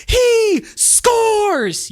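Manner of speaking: high, loud goal cry with a drawn-out SCORES; held-note goal shout. Shown as a demonstration. Coach showing the last of three goal calls, held shout. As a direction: belt it out and hold the note on "scores". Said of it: "hold the note a little bit".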